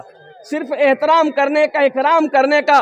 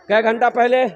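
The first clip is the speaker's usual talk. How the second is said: usual speaking voice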